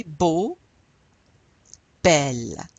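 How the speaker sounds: Beau then belle said slowly and clearly; pronunciation model. Shown as a demonstration. Teacher models beau and belle for repetition. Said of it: "So repeat after me".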